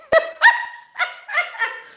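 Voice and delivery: high, loud burst of laughter. Real, not acted. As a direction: laugh out loud at your own story. Laughing at her own story.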